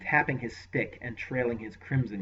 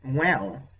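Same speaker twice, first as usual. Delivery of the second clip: smug; 3 semitones higher, 7 dB louder than usual. Acted. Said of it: "Rabadath answered smugly".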